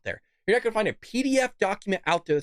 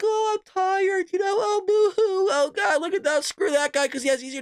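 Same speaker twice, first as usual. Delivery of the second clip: mock crybaby; 12 semitones higher than usual. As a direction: ham up a whining crybaby. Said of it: "You don't see me complaining, like".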